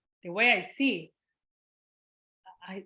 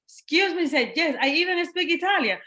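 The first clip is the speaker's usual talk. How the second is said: high, louder indignant retort; raised, emphatic mock indignation; 6 semitones higher, 5 dB louder than usual. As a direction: answer the doubters proudly. Retold people doubting her.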